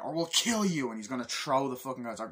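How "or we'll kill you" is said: higher, louder threat; mock threat. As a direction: threaten them. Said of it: "you know".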